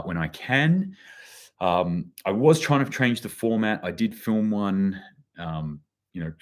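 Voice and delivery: ordinary talk, nothing marked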